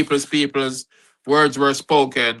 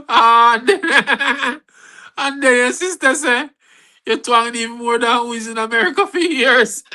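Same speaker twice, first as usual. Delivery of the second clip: laughing; 9 semitones higher than usual. Real, not acted. Retelling sister's teasing, laughing.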